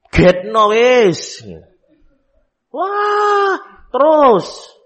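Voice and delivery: high, loud mock cry of a fussing child, slowed. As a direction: ham up a wailing kid. Child crying scene acted out. (suara anak merengek dibuat-buat, tinggi dan keras)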